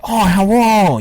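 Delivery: hammed-up Chinese accent on a short greeting; caricatured Chinese accent. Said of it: "very racially stereotypical accent, say, of a Chinese person".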